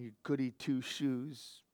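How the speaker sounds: slow, soft mocking jeer; sneering insult. Preacher imagining Cain jeering at Abel, slow soft sneer. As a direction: sneer it at the good brother.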